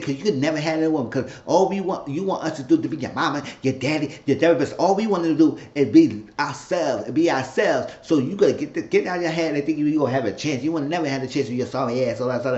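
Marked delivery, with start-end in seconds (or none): none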